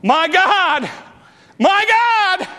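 high, loud cry to heaven. Acted. Jesus calling to God from the cross, crying out loud. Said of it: "when Jesus cried from the cross".

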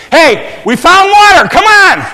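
high, full-volume shout to people far behind; urgent loud relay call; calling back. Acted. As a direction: holler the news back. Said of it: "he turns back and says".

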